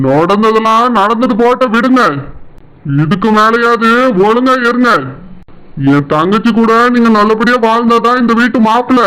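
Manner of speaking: a lightly voiced stern elder brother-in-law; a hint of a stern older brother. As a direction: scold him as the wife's protective brother. Brother-in-law warns the husband.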